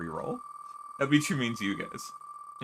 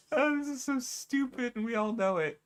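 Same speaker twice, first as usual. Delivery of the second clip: much higher, laughing disbelief; amused high voice; 12 semitones higher than usual. Real, not acted. Streamer laughs at her silly creation, voice high. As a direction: squeak it out through amusement.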